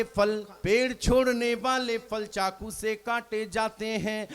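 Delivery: measured recited couplet, each line dwelt on; poem recitation. Acted. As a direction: recite it like a stage poet. Poet reciting verse.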